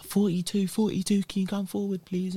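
high, carrying mock announcer call; mimicking a staff member calling a queue number. Announcer calling numbers over speaker.

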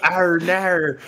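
high, exaggerated Australian "oh no"; hammed-up Australian whine; Australian accent. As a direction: wail it in broad mock Australian. Repeating the Australian accent joke.

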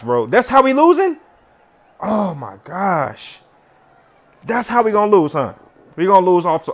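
normal talking voice

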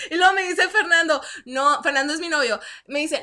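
ordinary talk, nothing marked

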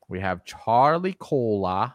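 bad Boston accent on the name; lightly put-on Boston accent. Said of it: "that was a terrible Boston accent".

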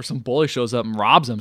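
ordinary talk, nothing marked

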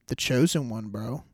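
ordinary talk, nothing marked

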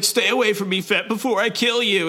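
hammed-up cornered cyborg; mock threatening Valance. Valance on his knees threatening Fett, high put-on voice.

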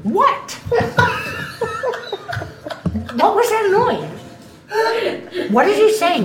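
high startled cries; jumpy and alarmed. Acted. Character startled by voice.

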